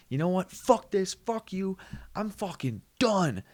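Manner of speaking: hammed-up fed-up dad; mock angry dad. Dad storming off from his girlfriend, mock furious.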